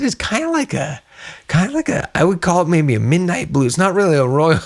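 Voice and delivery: high, hemming and hawing; retold hesitant description. Replaying own hesitant colour description.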